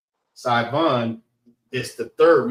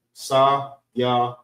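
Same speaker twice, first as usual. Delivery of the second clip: Sa-ya-wan in three separated, even beats; syllable by syllable. Shown as a demonstration. Teacher explaining three syllables, Sa-ya-wan split evenly. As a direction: count out Sa-ya-wan one syllable at a time. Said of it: "Three syllables".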